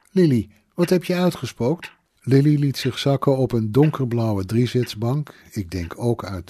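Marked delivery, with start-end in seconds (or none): 0.1-2.0 higher-pitched reproachful question; alarmed scolding. A narrator's reading. Mother confronts her daughter Lily, alarmed scolding. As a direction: demand an explanation, voice raised in pitch.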